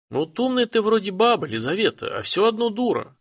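high, sharp scolding granny voice; old granny scolding the widow; scolding old woman. A narrator's reading. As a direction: scold her like a tough old babka.

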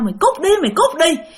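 high yelled "get lost"; a frightened father yelling at the ghost. Retold or imitated. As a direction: shout at the ghost while throwing stones. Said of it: "vừa chọi vừa la".

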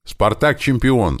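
high, drawn-out happy cry; joyful shout. A narrator's reading. Tim recognising the signal, joyful high shout. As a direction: cry it out in delight, slowly chanted. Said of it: "обрадовавшись, крикнул Тим".

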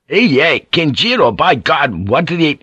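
high, loud outburst cut short; furious protest. A narrator's reading. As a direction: explode in outrage as hands seize you. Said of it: "Furious, Rodriguez leaped back".

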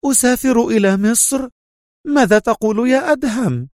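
high-pitched woman's startled questions; astonished exclamation. A narrator's reading. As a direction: exclaim in disbelief, pitch right up. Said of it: "في دهشة وصاحت". (صيحة دهشة بنبرة عالية)

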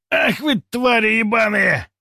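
high, slow furious cry; furious outcry. Acted. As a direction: cry out in rage. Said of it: "вскрикнул второй пулемётчик".

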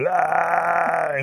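high, loud, long held cry; mock hollering wail. A narrator's reading. Rabbit still hollering in the bushes, wailing loudly. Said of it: "He's still hollering".

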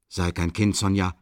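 ordinary delivery

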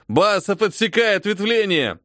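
high urgent command; shouting orders over battle. A narrator's reading. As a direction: bark the order at the private. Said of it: "скомандовал сержант". (сержант командует Басову)

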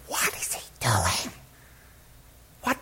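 hushed, incredulous aside to a friend; breath-only whispered question; stage whisper. Acted. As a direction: whisper it like a puzzled bystander.

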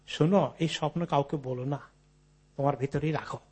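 low, softened voice urging secrecy; hushed retold warning, voice dropped; confiding. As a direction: quietly caution her, as if sharing a secret. Brother urges sister to secrecy.

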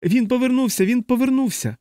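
high shrill screaming, panicked; hysterical shriek. A narrator's reading. Dunbar shrieks to the ward in hysterical terror. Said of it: "істерично заверещав Данбар".

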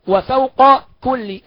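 "وفوق كل" very slow, q then k; unmerged qaf. Shown as a demonstration. Teacher pointing out qaf beside kaf, "وفوق كل" said unmerged. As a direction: draw out wa-fawqa before "كل", both letters clear. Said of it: "لا، ما ندغمش".

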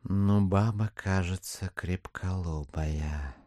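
low, slow mutter to himself; muttered aside. Acted. Chichikov to himself about the stubborn widow, low muttered aside. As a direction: drop the voice, drag out the last word.

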